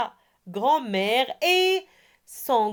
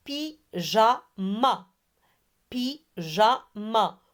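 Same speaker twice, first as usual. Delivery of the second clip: PYJAMA slowly, split py-ja-ma; three separate beats on "Pyjama"; syllable by syllable; slower than usual. Shown as a demonstration. Teacher has pupils repeat Pyjama, syllable by syllable. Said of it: "première syllabe et troisième syllabe".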